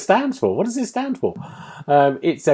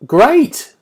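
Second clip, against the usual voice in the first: higher and louder praise; excited; 7 semitones higher, 8 dB louder, slower than usual. Real, not acted. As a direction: gush about the game. Enthused after the game.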